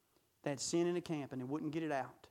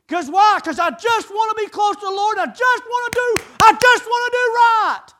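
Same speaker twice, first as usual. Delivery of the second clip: impassioned high shout, far louder than talk; full-force preaching; 12 semitones higher, 16 dB louder than usual. Real, not acted. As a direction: cry it out as a heartfelt plea.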